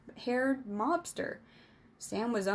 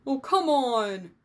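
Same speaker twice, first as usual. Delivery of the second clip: higher, drawn-out exasperated whine; loud protest; 4 semitones higher, 8 dB louder, slower than usual. A narrator's reading. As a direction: protest loudly, stretching it out slowly. Punched friend protests.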